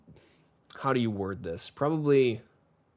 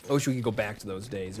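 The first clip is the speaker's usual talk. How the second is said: usual speaking voice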